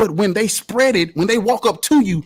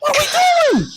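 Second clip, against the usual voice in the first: incredulous shout. Real, not acted. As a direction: throw the question at the whole room, outraged. Incredulous outburst in debate.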